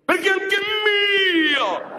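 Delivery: drawn-out, high yell on anche; slow high shout. Shown as a demonstration. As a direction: stretch it and shout it, claiming it. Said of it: "No, tu devi dire". (grido lento e acuto)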